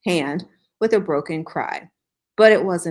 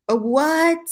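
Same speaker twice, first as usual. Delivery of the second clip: startled high "what?"; surprised; 9 semitones higher, 4 dB louder than usual. Real, not acted. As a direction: caught off guard, pitch jumps. Reacts in disbelief.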